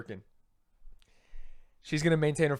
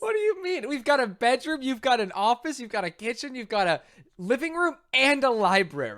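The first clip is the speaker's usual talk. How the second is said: higher, incredulous listing of rooms; 7 semitones higher than usual. Real, not acted. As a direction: act baffled she can't find space. Mock-defensive listing rooms.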